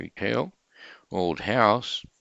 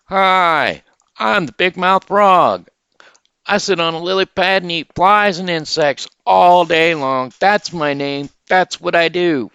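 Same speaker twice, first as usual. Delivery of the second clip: high, loud, booming big-mouth frog; high, loud frog shouting hello to the rabbit; 11 semitones higher, 10 dB louder than usual. Acted. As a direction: shout the greeting like a proud frog. Frog greets cow.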